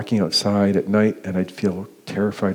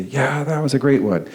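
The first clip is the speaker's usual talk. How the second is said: high, upbeat imitation of pleased colleagues; mock celebration; 6 semitones higher, 4 dB louder than usual. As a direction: cheer it like a high-five after a catch. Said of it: "they'd high-five each other and say".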